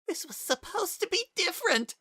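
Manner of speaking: high, broken sob; sobbing. A narrator's reading. Kara breaking down to Frisk, high and sobbing. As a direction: cry it out, pitch way up. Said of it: "he sobbed".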